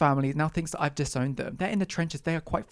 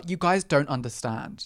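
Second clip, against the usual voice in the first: usual speaking voice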